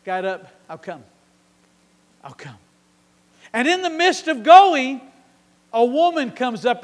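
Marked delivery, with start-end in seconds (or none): 0.7-1.0 calm promise. Acted. Jesus answers quietly. 2.2-2.6 much softer, low and gentle; soft reassurance. Acted. Jesus softly assuring the father, I'll come.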